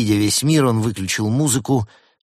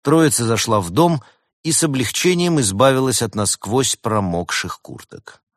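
ordinary delivery

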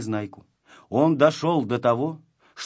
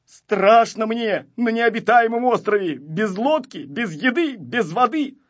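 high, fretful lament; acted scared voice, raised in pitch; frightened whining; 8 semitones higher, 5 dB louder than usual. A narrator's reading. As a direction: whine out each fear in turn. Frightened boy stranded on island.

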